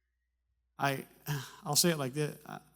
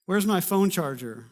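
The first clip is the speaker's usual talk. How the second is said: slow, louder, blunt demand; matter-of-fact wife tone; 5 semitones higher, 8 dB louder, slower than usual. Retold or imitated. Wife demanding her husband find charger, blunt. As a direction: talk down to him a little. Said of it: "the tone says dummy".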